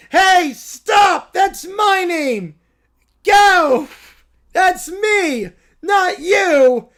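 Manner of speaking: put-on high, loud bratty shouting child; angry whiny kid. Caillou yelling at the goat over his name, bratty shout. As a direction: yell it like a furious little kid.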